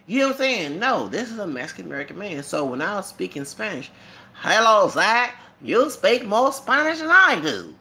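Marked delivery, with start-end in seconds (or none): 4.3-7.7 high, loud Texan principal. Retold or imitated. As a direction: put on a principal's big Texan accent.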